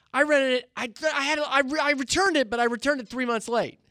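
high bragging inmate. Retold or imitated. The inmate boasts about the car, in a high voice. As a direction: brag like the car borrower. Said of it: "he says".

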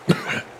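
faint breathy cough; a dying man's weak cough. Acted. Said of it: "A cough".

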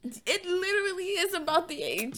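high, slow protest; indignant. Real, not acted. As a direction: object loudly, drawn out. Protesting friend.

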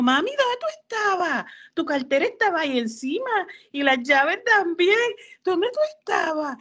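put-on high, whiny worried kid voice; worried son. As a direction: play a fretting child asking where mom went.

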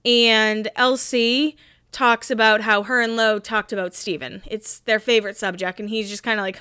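normal talking voice

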